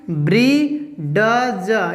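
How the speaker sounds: very slow ब्री ड ज, each sound separate; wrong sound-by-sound reading. Shown as a demonstration. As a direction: break it into ब्री, ड, ज with the d fully voiced. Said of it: "यहाँ पे इस तरह नहीं". (ब्री ड ज, हर आवाज़ अलग और धीरे)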